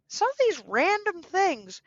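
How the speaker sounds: ordinary delivery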